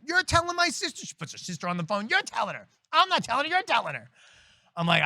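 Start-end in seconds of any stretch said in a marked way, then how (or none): 0.0-1.0 high shouted order; screaming demand. Retold or imitated. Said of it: "she starts screaming at me". 2.1-4.1 put-on upset girlfriend.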